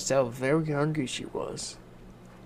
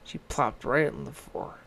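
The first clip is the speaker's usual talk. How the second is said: ordinary delivery, nothing marked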